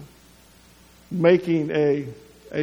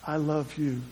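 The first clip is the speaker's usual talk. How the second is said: tender soft; 3 semitones lower, 7 dB quieter than usual. Acted. Jesus voiced on the cross.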